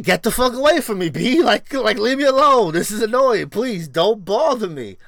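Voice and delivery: stung again, telling the bee off loudly; fed up with bees. Retold or imitated. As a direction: shoo the bee away in a huff. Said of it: "now I'm like".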